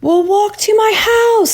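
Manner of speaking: high-pitched teacher's call, pushed out; yelling over the wind. A narrator's reading. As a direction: call out to the children above the storm. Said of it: "Miss Freeman was yelling".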